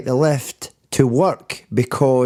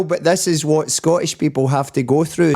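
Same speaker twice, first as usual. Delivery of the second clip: ordinary talk, nothing marked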